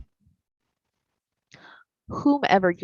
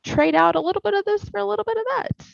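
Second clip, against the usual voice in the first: much higher, bubbly encouraging voice; cheerleading; 9 semitones higher than usual. Shown as a demonstration. Therapist showing the inner cheerleader, high excited praise. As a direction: cheer yourself on, bright and eager.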